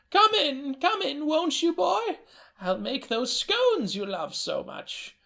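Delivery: high quavering grandmother voice; a doting old nana greeting her boy; delighted old woman greeting her grandson, high-pitched. Acted. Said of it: "the elder".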